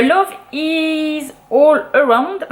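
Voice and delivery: slow, drawn-out title in an English accent. Shown as a demonstration. Booktuber reads an English book title in an English accent. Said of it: "accent anglais est super".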